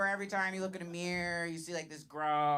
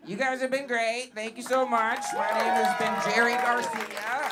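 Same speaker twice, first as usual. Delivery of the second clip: loud, high-pitched thanks to the crowd; rousing sign-off; 6 semitones higher, 9 dB louder than usual. Real, not acted. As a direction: call out over the applause. Comic signs off to applause.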